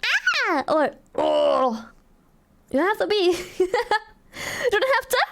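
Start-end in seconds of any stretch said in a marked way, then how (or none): 0.0-0.6 higher demo. Shown as a demonstration. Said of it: "Anyone can be like". 1.1-1.9 lower demo. Shown as a demonstration. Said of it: "Anyone can be like".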